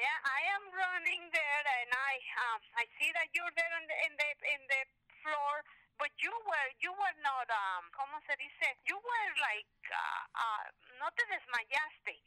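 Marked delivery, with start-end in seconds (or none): none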